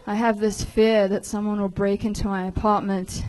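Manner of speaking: ordinary talk, nothing marked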